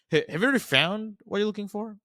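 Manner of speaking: curious question that hits "found"; emphasis on found. Shown as a demonstration. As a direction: lean on "found" and lift the question up high. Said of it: "Also, emphasis on the found".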